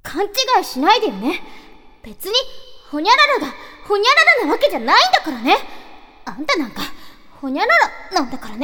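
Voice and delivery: high, loud, fast tsundere voice. Acted. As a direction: huff it out like a flustered anime girl. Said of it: "規定セリフ言った方がいい".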